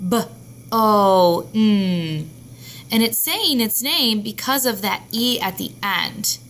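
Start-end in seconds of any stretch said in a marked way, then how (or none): 0.1-2.3 bone sounded B, O with a louder O, N; teacher modelling sounds of bone; sounding out. Shown as a demonstration. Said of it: "as in bone".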